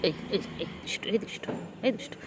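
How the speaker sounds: ফিসফিস করে আদুরে বকুনি; ফিসফিসিয়ে দুষ্টু বলে বকো; whispered teasing. Real, not acted. Whispered scolding of cat. (বিড়ালকে ফিসফিস করে আদুরে বকুনি)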